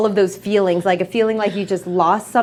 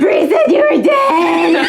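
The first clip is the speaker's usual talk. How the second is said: high, loud, slow wail; hammed-up, high-pitched crying shout; wailing outburst; 8 semitones higher, 6 dB louder, slower than usual. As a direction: bawl it out, dragging the words. Monty wails at revived Harper.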